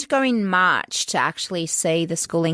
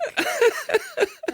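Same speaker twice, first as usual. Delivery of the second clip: corny dad laugh. Real, not acted. Reacting to a pun.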